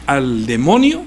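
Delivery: normal talking voice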